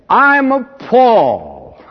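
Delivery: slow, high, mocking a smug churchgoer; mocking boaster. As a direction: parody the bragging member, drawn out.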